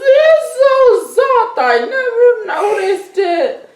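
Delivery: giddy high-pitched stoner awe; stoned and blissed out. Acted. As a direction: marvel at everything like you're high. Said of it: "They're very high".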